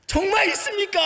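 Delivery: high, loud booming auntie voice; booming Jeolla lady. Retold or imitated. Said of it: "쩌렁쩌렁하게". (높고 큰 쩌렁쩌렁한 아주머니 목소리)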